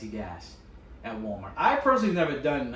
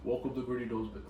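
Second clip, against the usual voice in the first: lightly lowered fake deep voice; forcing a deep voice; 3 semitones lower, 7 dB quieter than usual. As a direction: push the voice a little lower. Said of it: "you're literally faking it".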